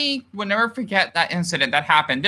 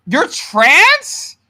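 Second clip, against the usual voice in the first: surprised; 7 semitones higher, 6 dB louder than usual. Real, not acted. Surprised by a viewer comment.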